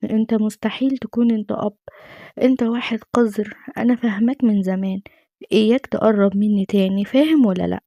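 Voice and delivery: usual speaking voice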